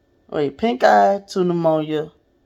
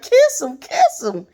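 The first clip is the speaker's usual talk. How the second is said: teasing sister; 8 semitones higher, slower than usual. Retold or imitated.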